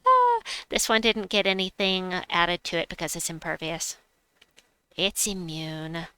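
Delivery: ordinary talk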